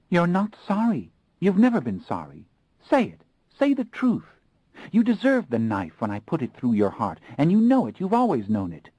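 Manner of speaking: put-on high, sharp child accusing him; a little girl pressing hard for a confession; child's accusing voice. A narrator's reading.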